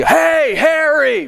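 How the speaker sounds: high call across the field; yelling a name. Retold or imitated. Fan shouting again to the player, high yell. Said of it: "Really loud, just yelling out onto the field".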